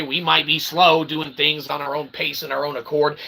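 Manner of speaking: ordinary talk, nothing marked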